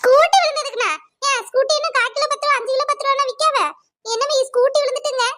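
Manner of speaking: a hint of an indignant neighbour complaining; scolding neighbour. Neighbour scolds cow owner over her broken scooter, indignant.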